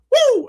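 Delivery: high loud whoop of delight; sudden high woo; excited woo. Real, not acted. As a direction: let out a happy cheer. Host cheers at background praise.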